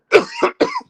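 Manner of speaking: sudden hard coughing; real cough. Real, not acted. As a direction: cough hard, interrupting yourself. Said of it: "I'm coughing right now".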